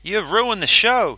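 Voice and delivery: high, loud, slow outburst; furious complaint. A narrator's reading. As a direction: blame him to his face. Kitamariki storms up angry.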